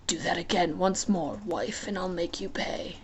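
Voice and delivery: low, hushed warning; lightly voiced dark god; low playful threat. Hades threat.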